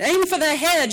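loud, high battle cry; fierce high shout to her husband. A narrator's reading. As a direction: urge him on at the top of her voice. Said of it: "she shouted".

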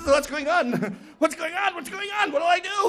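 high, frantic little voice; hammed-up squeaky panic; panicky hornet. Hornet king fears the flood, high panic.

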